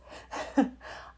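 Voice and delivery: a little embarrassed laugh under the breath. Real, not acted. Laughs at own tearfulness.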